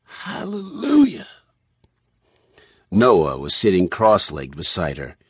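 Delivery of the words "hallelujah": high, slow, drawn-out voice of Ma; muttered praise. A narrator's reading. Ma to herself at the TV screen, muttered praise. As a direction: utter it slowly, high-pitched, as private praise. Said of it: "Ma stared at Elliot's image on the T V screen and muttered".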